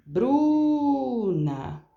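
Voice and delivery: BRUNA called out high with a long held BRU; calling out at gate. Acted. Student calls teacher at her gate, calling out BRUNA long and high. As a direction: call someone from the front gate, stretching the first syllable of BRUNA. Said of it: "me chamar pelo meu nome lá no portão".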